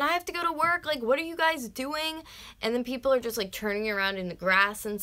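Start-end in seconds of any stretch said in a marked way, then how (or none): 0.0-2.2 retold screaming. Out of the car, yelling at the stuck drivers, a mild mock yell. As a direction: call out, exasperated and late for work. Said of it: "I'm screaming".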